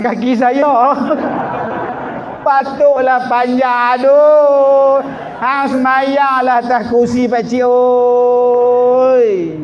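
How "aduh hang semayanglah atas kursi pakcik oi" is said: louder, higher shout, last words very slow; mock shout. Shouting at the old man to pray on a chair.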